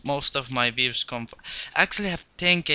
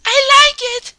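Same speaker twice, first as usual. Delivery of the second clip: high excited squeal; 10 dB louder than usual. Real, not acted. Loud squeal and laugh.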